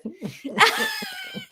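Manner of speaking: high burst of laughter. Real, not acted. Laughs at funny story.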